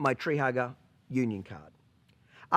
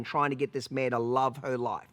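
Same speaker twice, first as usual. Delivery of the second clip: ordinary delivery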